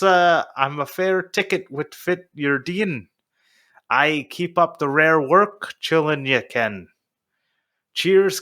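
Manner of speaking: hammed-up northeast Scottish reading; slow, stumbling fake Scottish brogue read aloud; horrible Scottish accent. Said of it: "that was my horrible Scottish accent".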